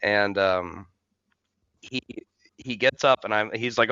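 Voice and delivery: ordinary talk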